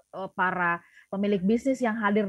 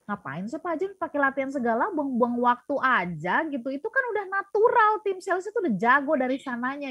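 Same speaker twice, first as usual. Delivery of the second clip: higher-pitched imitation of a sceptical boss; mock dismissive; 5 semitones higher than usual. Sales boss scoffing at Pak James's drills, mock dismissive. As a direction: scoff at it, pitch climbing as you go.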